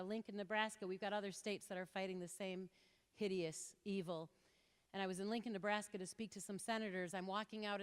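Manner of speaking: ordinary talk, nothing marked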